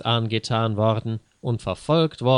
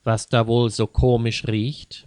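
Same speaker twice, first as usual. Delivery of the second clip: ordinary delivery, nothing marked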